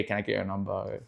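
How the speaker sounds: usual speaking voice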